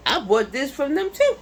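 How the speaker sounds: high and loud, hyped about the freezer; excited showing off. Real, not acted. Showing viewers the freezer she gambled on, thrilled. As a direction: show it off like a prize you won.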